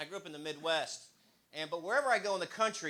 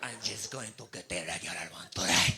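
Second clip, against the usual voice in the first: mock Spanish accent; 7 semitones lower than usual. Comic answering the cashier in a put-on accent. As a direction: fake a Spanish lilt back at her. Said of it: "And I said".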